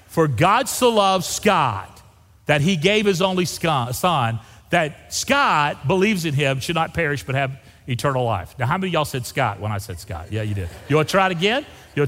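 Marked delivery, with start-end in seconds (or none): 0.1-8.5 slow, drawn-out lead for others to follow; slow group reading. Shown as a demonstration. Pastor leads the congregation in reading the verse together, slowly. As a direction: read it out slowly and deliberately. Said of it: "You Aggies will read it slow".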